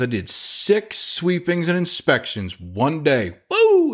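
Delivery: slow proud brag ending in a high whoop; bragging cheer. Retold or imitated.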